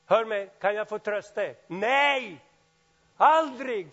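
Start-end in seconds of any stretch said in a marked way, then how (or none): 1.7-3.9 shouted emphatic no; loud refusal. Acted. (ropat bestämt nej)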